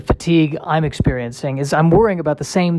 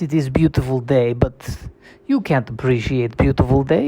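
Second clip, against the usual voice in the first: menacing mock Russian accent; ominous Russian accent; 4 semitones higher than usual. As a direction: warn them of death in a thick Russian accent. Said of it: "what the accent is really saying".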